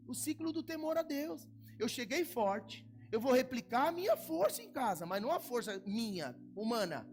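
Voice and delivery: high, loud, slow preacher's proclamation; climactic preaching. Acted. Pastor proclaiming God's strength to the church, loud and high. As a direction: build it to a ringing climax.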